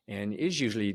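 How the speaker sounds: ordinary delivery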